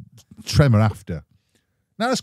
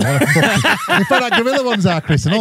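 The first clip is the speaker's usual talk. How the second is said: high, giggly laugh through the words; cracking up while he insults him; 11 semitones higher, 4 dB louder than usual. Real, not acted. Laughing at brother's photo.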